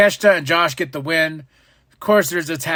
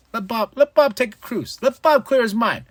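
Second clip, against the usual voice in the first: higher, easygoing; lightly voiced laid-back mentor; calm MVP; 7 semitones higher than usual. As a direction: soothe a friend. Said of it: "M V P's like".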